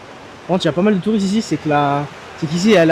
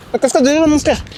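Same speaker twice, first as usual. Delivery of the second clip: excited shout; 9 semitones higher than usual. Real, not acted. First sight of waterfall.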